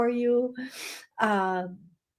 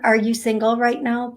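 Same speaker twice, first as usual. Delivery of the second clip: ordinary talk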